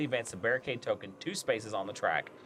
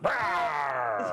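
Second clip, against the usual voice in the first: playful roar; playful monster growl. Pretend. Revealing zombie token, shout.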